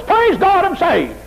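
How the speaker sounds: high, loud burst of praise; sudden hammed-up jubilant shout; mock excitement. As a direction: imitate an overexcited convert crying out. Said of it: "gets excited at the moment something happens".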